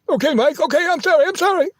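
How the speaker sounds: high, squeaky, frightened apology; cowering apology. Retold or imitated. Diner owner grovelling to the threatening trucker, high and squeaky. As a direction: shrink back and grovel.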